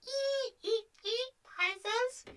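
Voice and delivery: very high, thin imitated squeaking; imitated squeal. Imitating the other one's cry for Puzzles, high mock squeal. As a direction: squeak it out as a mock character noise. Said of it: "You go".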